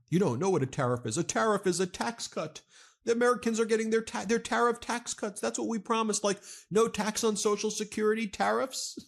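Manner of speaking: high-pitched, rushed mock spokeswoman; mock her in a high, fast babble; imitating a spokeswoman. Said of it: "and then she was like".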